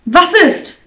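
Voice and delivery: high, loud exclamation; loud, high challenge; calling out. A narrator's reading. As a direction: call it out sharply, pitch leaping up. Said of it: "rief Barbara".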